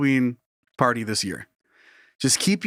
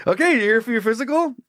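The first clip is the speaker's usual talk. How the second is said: raised-pitch voice over a fan; talking over noise; 7 semitones higher, faster than usual. Acted. Costumed doctor talks over the suit's fan, voice raised. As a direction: project the question above the whirr. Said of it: "he's talking over that of the fan".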